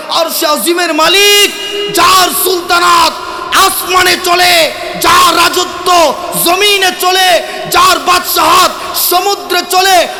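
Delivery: high, forceful preacher's cry; full-force preaching. Real, not acted. Preacher exalts the Lord of the Throne, full-force preaching. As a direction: build the praise in punched, ringing phrases.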